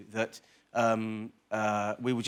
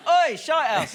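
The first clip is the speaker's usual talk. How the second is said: character voice; 9 dB louder than usual. Retold or imitated. Mocking Whitehouse in character.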